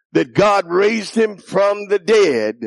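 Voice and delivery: normal talking voice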